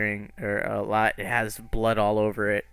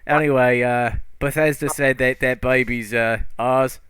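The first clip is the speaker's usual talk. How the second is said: louder, breezy fairy voice; loud, offhand trickster; Puck's voice; 3 semitones higher, 3 dB louder than usual. Acted. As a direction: casually claim the baby as a cheeky fairy. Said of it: "He's like".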